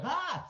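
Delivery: high mock yelp of alarm; high startled yelp; startled yell. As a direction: yelp like you just saw a dogfight. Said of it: "we were like".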